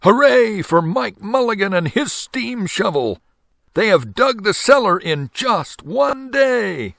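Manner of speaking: high, ringing cheer, slow at the end; crowd cheering. A narrator's reading. Townspeople cheering Mike Mulligan, high and joyful. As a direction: celebrate the record dig. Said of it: "shouted the people".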